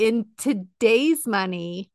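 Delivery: slow, drawn-out teaser; slow dramatic build-up. Pretend. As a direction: stretch it out to build suspense. Dramatic build-up to big figure.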